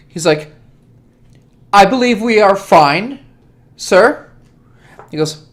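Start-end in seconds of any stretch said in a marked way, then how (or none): 1.7-4.3 a lightly raised, louder reply to the prince; a respectful manservant, a touch higher; servant answering. Acted. The old servant assures his prince, lightly voiced.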